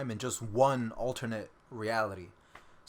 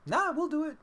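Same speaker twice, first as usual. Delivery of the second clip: high, tossed-off mock reply; playful high-pitched dismissal; voiced studio. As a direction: answer as the studio in a high put-on voice.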